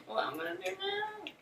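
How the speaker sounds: very high, soft little voice; playful helpless wail. Pretend. Vlogger fretting over her wet contour brush, high soft mock wail. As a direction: squeak it out quietly in mock despair.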